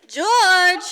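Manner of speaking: loud call for George. Acted. Mary calls George back to the phone, loudly. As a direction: call him back from the door.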